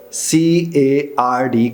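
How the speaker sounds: slow letter-by-letter spelling; spelled out. Shown as a demonstration. Teacher spelling card for students, slow and deliberate. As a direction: spell out C A R D slowly. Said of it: "एक लफ्ज़ है खार".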